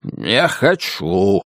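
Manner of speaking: low, very drawn-out refusal; deep, slow dragon voice; refusing dragon. A narrator's reading. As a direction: stretch it out like a stubborn dragon. Dragon refuses to eat them.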